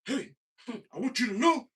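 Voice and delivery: high and loud, like an earnest hype leader; mock the energy-speech guy in a high loud voice; high preachy voice. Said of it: "somebody goes".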